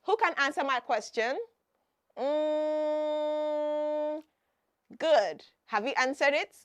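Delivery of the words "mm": long, steady, slightly raised "Mm"; long waiting hum. Pretend. Teacher waiting for children to answer her question, long waiting hum. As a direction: hum and hold it, as if listening for answers.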